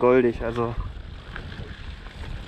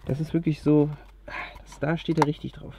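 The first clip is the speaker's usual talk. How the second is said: whispering; 6 dB quieter than usual. Real, not acted.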